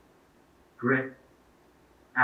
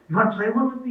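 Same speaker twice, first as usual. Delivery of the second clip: higher, eager invitation; eager invite; 10 semitones higher, 4 dB louder, faster than usual. Retold or imitated. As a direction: ask it like a keen fan. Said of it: "He's like".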